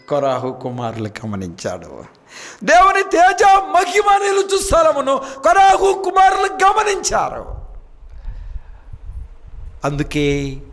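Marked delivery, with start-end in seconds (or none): 2.6-7.4 very high, loud shout; preacher at full fervour shouting the verse; full preaching shout. Acted. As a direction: roar it out with full force.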